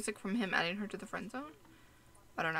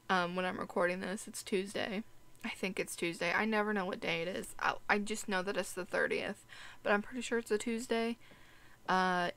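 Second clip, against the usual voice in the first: ordinary talk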